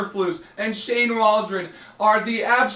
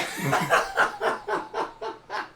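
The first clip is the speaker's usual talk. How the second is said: quiet wheezing laugh; breathless laugh; 13 semitones higher, 6 dB quieter than usual. Real, not acted. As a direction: laugh without voice. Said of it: "the reason why I'm laughing so hard".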